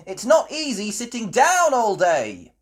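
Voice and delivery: high, fed-up complaint; restless lady voice. A narrator's reading. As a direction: whine about sitting still. Miss Rabbit bored complaint.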